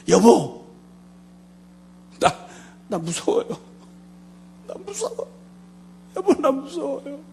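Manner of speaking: wife crying to husband on a long-distance call; imitating crying wife. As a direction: voice the wife's frightened sobbing words. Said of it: "막 우는 거예요".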